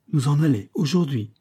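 surprised refusal; astonished. A narrator's reading. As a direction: balk at the idea, mildly amazed. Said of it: "son front se plissait d'étonnement".

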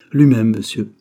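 understated, firm-voiced reply; simple dignity. A narrator's reading. Old man confirming who he is, with simple dignity. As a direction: answer simply, with a strong voice. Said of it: "avec une simplicité antique".

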